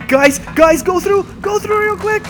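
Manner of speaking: high, loud urgent call; urgent shout. Real, not acted. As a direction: hurry the team through. Urging team past monster.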